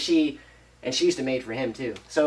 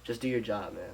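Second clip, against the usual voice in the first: low-key, softer spiteful dismissal; spiteful order; 3 semitones lower, 8 dB quieter than usual. Retold or imitated. Rich father ordering the flooded-out man, spiteful. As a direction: say it quietly with restrained spite. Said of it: "like, spiteful shit".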